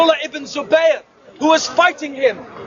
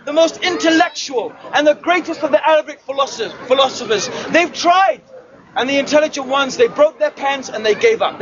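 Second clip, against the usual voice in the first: normal talking voice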